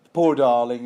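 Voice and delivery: loud, mock-sympathetic patronizing coo. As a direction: lay on the condescending pity. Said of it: "this has been all patronizing".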